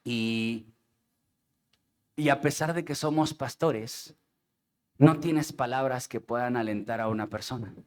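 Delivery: voz baja y pausada por el luto; tristeza real. Real, not acted. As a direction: cuenta con pesar la muerte del primo. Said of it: "es triste". (con tristeza contenida, más bajo y despacio)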